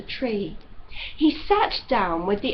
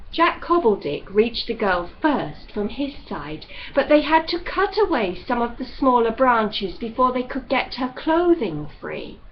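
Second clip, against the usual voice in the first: usual speaking voice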